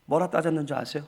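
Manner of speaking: usual speaking voice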